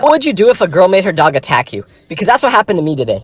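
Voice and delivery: loud, punchy opening question; loud hook opener. Real, not acted. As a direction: grab the viewer at once.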